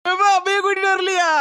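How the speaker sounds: high tearful wail; crying. Acted. Daughter asks father why mother didn't come, high crying voice. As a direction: wail the question, pitched right up. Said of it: "அழாத மக்களே, இப்ப எதுக்கு அழுதா".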